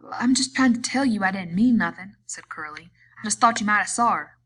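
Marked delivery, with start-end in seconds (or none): 0.1-2.2 loud protest. A narrator's reading. Curly protests again. 3.2-4.4 loud protest. A narrator's reading. Curly protests again.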